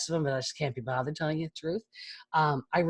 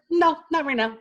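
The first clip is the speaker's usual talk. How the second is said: imitating her son; 11 semitones higher, 5 dB louder than usual. Mother voicing son's reply.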